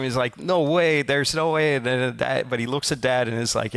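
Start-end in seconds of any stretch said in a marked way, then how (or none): 0.4-1.8 higher, louder mock tantrum; imitating a kid throwing a fit; kid's tantrum. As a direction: whine it like a child refusing. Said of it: "the son throws this giant tantrum".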